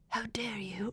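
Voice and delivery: breathless whispered outrage. Retold or imitated. Imitating Walken whispering at the aliens during the probe scene. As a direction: hiss it out under your breath, affronted. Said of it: "he goes".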